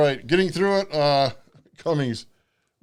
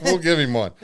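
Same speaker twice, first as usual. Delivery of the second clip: ordinary delivery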